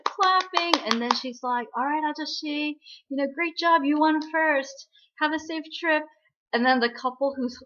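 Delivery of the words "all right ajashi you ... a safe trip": higher-pitched acted praise; cheering congratulations. Sending off the ghost who finished the race, cheering congratulations. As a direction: congratulate brightly, pitch held up.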